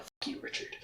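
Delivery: voiceless whispered curse; whispered aside. Retold or imitated. Joking Darken Rahl cursing Richard in a whisper. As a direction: breathe it as a sly joke.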